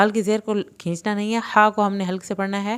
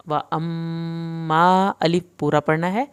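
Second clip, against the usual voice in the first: ordinary delivery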